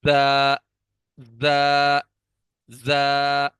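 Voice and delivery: زا buzzed hard, tongue kept inside; a forceful buzzing زا, repeated; firm za. Shown as a demonstration. Teacher drills a strong زا for the class. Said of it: "سختی سے زا کو پڑھیں گے".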